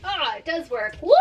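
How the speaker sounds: delighted whoop breaking into a high, loud laugh; real excitement. Real, not acted. Vlogger finds the storage bar works, excited whoop. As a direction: burst out in a high victory woo. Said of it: "Just got so excited just now".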